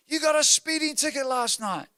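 high-pitched mock accusation; outraged daughter imitation. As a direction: accuse like a scandalized kid, voice up high. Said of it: "and goes".

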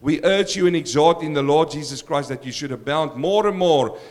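usual speaking voice

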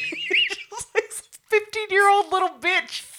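giggling, can barely get it out; laughing high voice. Real, not acted. Laughing to a friend about the girl, high giggling voice.

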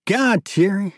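high, lively exclamation; excited younger brother. Acted. Younger brother raving to Thierry about a woman, excited.

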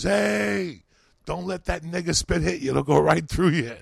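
loud, high yell from a booth; shouting at kids. Retold or imitated. Park worker yelling at the kids, loud and high. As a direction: call out across the park.

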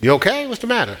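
startled concern. Retold or imitated. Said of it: "I was like".

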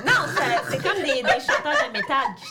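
talking through laughter; laughing. Real, not acted. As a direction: still laughing as you speak. Laughing while explaining.

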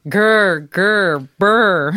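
growling booms. Retold or imitated. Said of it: "another one said".

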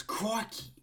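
put-on high-pitched catchphrase yelp in an Aussie accent; Steve Irwin. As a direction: imitate an excitable croc hunter, pitch leaping up. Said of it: "where he says".

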